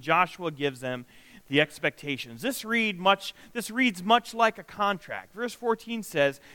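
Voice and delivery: ordinary talk, nothing marked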